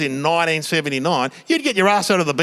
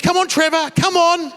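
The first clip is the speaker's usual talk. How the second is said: higher, sing-song coaxing; coaxing mum; 11 semitones higher than usual. Retold or imitated. Mum coaxing Trevor down from the tree. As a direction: lure him down gently. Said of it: "Mum has to lure him down".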